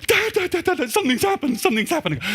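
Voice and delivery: very high, urgent calling of dad; excited teen. Retold or imitated. Son running upstairs to tell his father big news, very high and excited. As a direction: burst in breathless with news, pitch way up.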